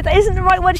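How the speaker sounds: the dog grumbling it's the wrong cue; dog's thoughts. Retold or imitated. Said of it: "she goes".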